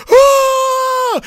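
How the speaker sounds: high loud shocked cry; crowd gasp-scream. Retold or imitated. Crowd reacts to vomiting.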